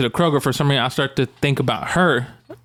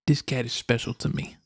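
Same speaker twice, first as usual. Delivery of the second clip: hushed, grave mock confession; imitated solemn vet; 8 dB quieter than usual. Vet tells adopters about his cat, hushed and solemn. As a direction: say it softly, as the protective vet. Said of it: "He says it like that".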